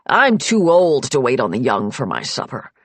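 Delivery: a hint of an impatient old man; grumbling old man. Old patriarch refusing to wait for late guests, grumbling.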